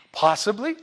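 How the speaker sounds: ordinary talk, nothing marked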